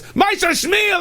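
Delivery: very high, loud shout of a name; exasperated boss screaming; angry yell. Acted. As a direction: holler at the worker. Acted master yelling at worker.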